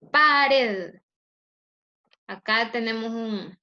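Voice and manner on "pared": "Pared" loud and stretched on PA; penultimate-syllable stress. Shown as a demonstration. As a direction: push hard on the start of "Pared". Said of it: "esta es la penúltima sílaba".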